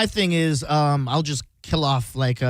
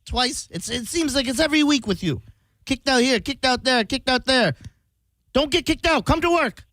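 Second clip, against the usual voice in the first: high, scolding Middle Eastern boss; Middle Eastern boss accent; 7 semitones higher than usual. Acted. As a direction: nag the employee in a put-on Middle Eastern accent. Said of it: "of Middle Eastern descent".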